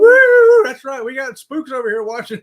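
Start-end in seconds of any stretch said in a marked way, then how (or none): 0.0-0.6 big high loud woo; excited whoop. Real, not acted. Host whooping about supernatural viewers, very loud. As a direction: let out a joyful holler.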